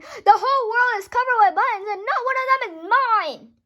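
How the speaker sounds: loud, high furious scream; screamed in fury. Acted. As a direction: scream it, jumping with rage.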